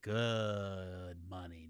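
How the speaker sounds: GOOD stretched out long and slow, savouring it; drawn-out emphasis. Real, not acted. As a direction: draw out the word "good" with relish. Emphatic drawn-out praise of the earnings.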